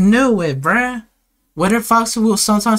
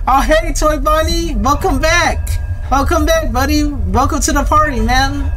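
high, over-friendly party host; mock-cheery welcome; 7 semitones higher than usual. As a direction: greet the monster like a welcome guest, pitched up. Hammed-up welcome for Toy Bonnie.

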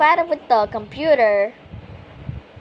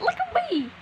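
squeaky voice; 7 dB quieter than usual. Acted. Showing off avatar.

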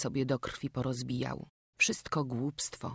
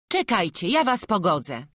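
louder, higher village woman; Magda's voice; 5 semitones higher, 7 dB louder than usual. A narrator's reading. Magda steps in to settle the villagers' quarrel, raised voice. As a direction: step in loudly to settle it. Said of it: "odezwała się Magda".

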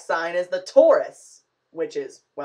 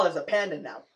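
ordinary talk, nothing marked

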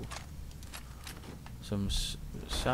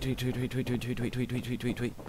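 mock birdsong. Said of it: "and all of a sudden, tweet".

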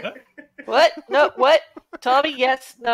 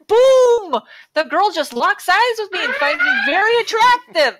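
higher, louder excited read; hammed-up boast; 7 semitones higher, 4 dB louder than usual. Reading a cologne review aloud, over-the-top boastful. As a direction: sell the miracle like an infomercial.

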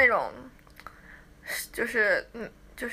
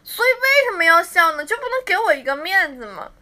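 mock indignant; 5 semitones higher, 6 dB louder than usual. Playfully scolding laughing fans.

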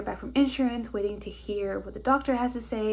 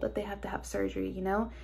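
whispering; 6 dB quieter, faster than usual. Real, not acted. Anxious aside about surgery.

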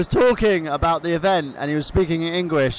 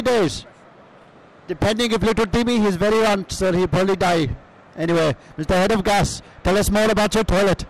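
put-on Iranian cousin accent. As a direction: play the foreign cousin with a thick accent. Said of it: "Michael Burhan's Iranian cousin".

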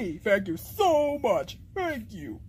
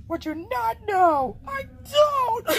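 tearful wailing; 8 semitones higher than usual. Acted. Confessing to friends while doomed, tearful wail. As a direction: bawl it out, climbing higher.

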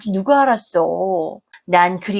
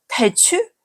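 incredulous; 11 semitones higher, slower than usual. Acted. Daughter incredulous at mother's excuse.

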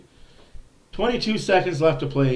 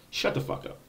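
whispered, much softer snap; hissed curse; 10 dB quieter than usual. Acted. Vlogger silencing commenters who mock the award, in a whisper. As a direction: hiss it under your breath at the doubters.

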